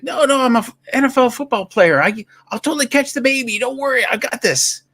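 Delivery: high, insistent hero voice; acted eager assurance. Football player reassuring mother above, high and eager. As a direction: insist breathlessly that it's handled.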